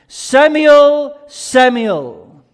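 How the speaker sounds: loud, high, drawn-out call of a name. Acted. God calls the boy Samuel by name, calling out. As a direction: call to someone in another room.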